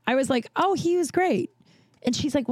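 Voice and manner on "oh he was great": high-pitched, breezy dismissal of an apology; breezy reassurance. Retold or imitated. Bartender to apologizing friend, breezily reassuring. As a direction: wave it off in a higher voice.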